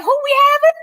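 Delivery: very high, incredulous laughing question; retold baffled reaction. Recalling not knowing the guest, high incredulous voice. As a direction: shoot the pitch right up in disbelief. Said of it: "I was like".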